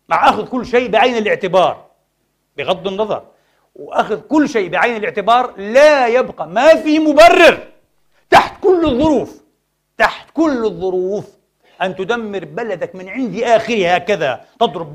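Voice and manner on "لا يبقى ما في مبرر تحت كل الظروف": loud, high, drawn-out insistence; impassioned shout. Real, not acted. Preacher rejecting any excuse for destroying Syria, impassioned shout. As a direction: cry it out to the congregation.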